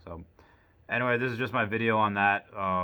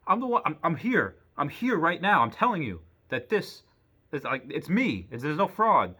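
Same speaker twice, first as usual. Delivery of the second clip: exasperated insisting; 7 semitones higher, 4 dB louder than usual. Retold or imitated. Pleading with bank clerk.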